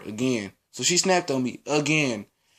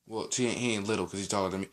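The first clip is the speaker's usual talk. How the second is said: quiet aside; 6 semitones lower, 9 dB quieter, faster than usual. Real, not acted. Quick correction aside.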